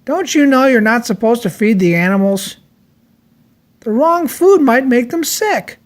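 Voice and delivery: a gentle telling-off, rising high; scolding zookeeper. A narrator's reading. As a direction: zookeeper scolding George for feeding animals, high scolding voice. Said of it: "he said".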